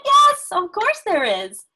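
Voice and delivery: high, loud, delighted yes; storybook witch's eager yes, starting very high; delighted welcome. A narrator's reading. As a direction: exclaim it brightly as the welcoming witch.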